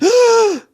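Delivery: sudden high gasp of panic; sharp gasp. Shown as a demonstration. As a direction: gasp in shock as if stressed. Demoing stress, sharp gasp.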